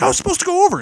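high, loud wail of injustice; outraged protest. Acted. Eliminated wrestler to the referee, high outraged protest. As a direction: cry foul at the top of your voice.

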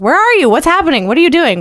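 high, loud frantic questions. Retold or imitated. Texting her partner, frantic and loud, pitched high. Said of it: "I'm like".

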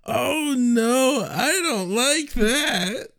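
high, slow pretend distress; hammed-up frightened whine; mock whimper. Reacting to a friend's horror sound, mock whimper.

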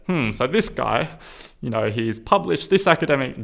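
mock live blog. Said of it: "did this in my live blog".

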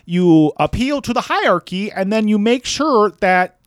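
high, fast mock Jordan Peterson voice; Jordan Peterson impression. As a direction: lecture it in a parody Jordan Peterson voice. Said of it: "you sounded like George Bailey".

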